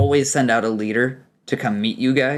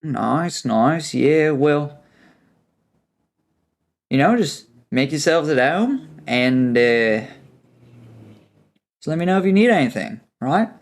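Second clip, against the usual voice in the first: lightly Kiwi host voice; easygoing New Zealand welcome; Kiwi accent; 4 semitones higher than usual. Acted. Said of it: "This is my attempt at a Kiwi accent".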